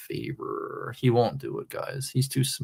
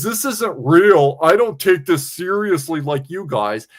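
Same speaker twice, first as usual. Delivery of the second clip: louder, slow mock quote of JT; 4 semitones higher, 5 dB louder than usual. Streamer mocking JT's words to chat. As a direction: sneer it back in his voice. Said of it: "He says".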